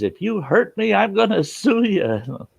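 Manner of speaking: high, indignant threat; mock threatening woman. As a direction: imitate the trapped woman threatening to sue. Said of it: "she says".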